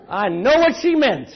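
ordinary talk, nothing marked

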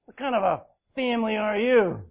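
loud, high demanding challenge; hammed-up scolding, pushing for an answer; mock confrontation. Woman marching in on her kinsman, mock demanding. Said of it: "She could have marched in".

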